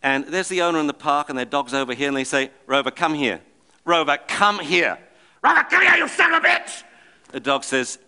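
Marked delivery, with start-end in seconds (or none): 2.6-5.0 mildly raised calling across the park; calling the dog. Retold or imitated. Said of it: "screaming like that". 5.4-6.8 much higher, louder imitated yell at the dog; mock screaming. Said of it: "screaming like that".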